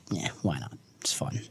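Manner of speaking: soft unvoiced whisper. Real, not acted. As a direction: whisper it to yourself. Bold prediction aside.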